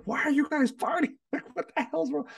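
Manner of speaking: high-pitched mock yell at the dogs; exasperated, squeaky re-enacted yell, half laughing; retold yelling.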